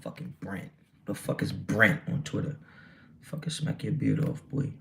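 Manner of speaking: low, breathy mutter to himself; mumbling aside at a viewer named Brent; muttered under breath. Real, not acted. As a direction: grumble it half-whispered.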